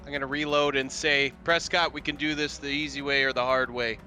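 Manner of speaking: ordinary talk, nothing marked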